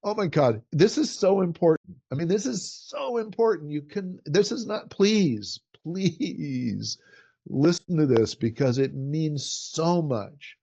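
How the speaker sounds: high, pitch-lifted, emotional gush; excited, pleading delivery that rises high then slows on the last line. Shown as a demonstration. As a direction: pour feeling into every word, begging them to listen. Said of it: "But if I go".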